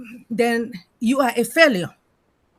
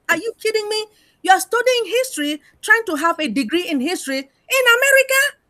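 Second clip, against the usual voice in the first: incredulous; 9 semitones higher, 3 dB louder than usual. Acted. Mock disbelief at studying history here.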